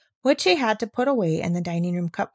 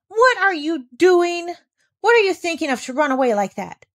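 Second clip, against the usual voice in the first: Rottenmeier calling out; 7 semitones higher, 4 dB louder than usual. A narrator's reading. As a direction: call her back sharply. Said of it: "called Miss Rottenmeier".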